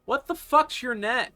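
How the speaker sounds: high, loud irritated demand; acted yell. Stranger yells at approaching pilot for the radio channel, acted yell. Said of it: "the guy yells".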